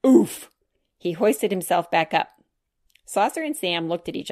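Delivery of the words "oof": effort grunt. Acted. Hoisting himself back up.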